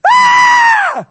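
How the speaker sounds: piercing high, loud shriek. Acted. Preacher startles listeners acting out the medium seeing Samuel, shrieking. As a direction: let out a sudden full shriek. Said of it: "No, she shrieked".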